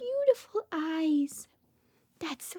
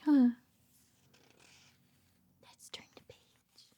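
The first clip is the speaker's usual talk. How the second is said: plain reading voice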